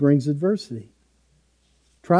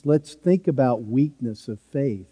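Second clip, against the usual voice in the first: ordinary talk, nothing marked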